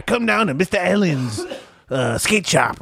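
high cartoon pitchman; Mister Allen. Imitating a skate shop commercial, cartoon salesman. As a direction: invite customers in like a cartoon salesman.

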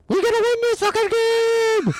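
put-on very high, squealed kid yell; Colton. Imitating his son's friend yelling during the game, high squeal. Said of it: "He just starts yelling".

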